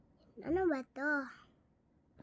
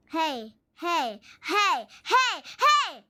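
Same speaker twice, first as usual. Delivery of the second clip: protesting yell; 3 semitones higher, 9 dB louder, much slower than usual. Real, not acted. Protesting at game.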